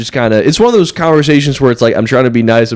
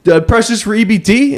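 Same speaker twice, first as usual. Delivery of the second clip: much higher chummy bro voice; bro impression; 11 semitones higher than usual. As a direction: narrate the card step to the cashier like a pal. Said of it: "He's like".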